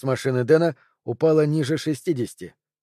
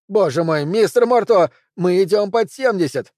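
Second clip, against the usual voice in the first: high, loud panicked cry; 6 semitones higher, 4 dB louder than usual. A narrator's reading. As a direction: yelp it in alarm. Pelvis panics at speed. (испуганный крик)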